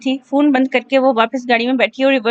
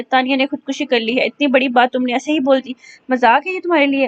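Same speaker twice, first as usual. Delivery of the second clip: ordinary delivery, nothing marked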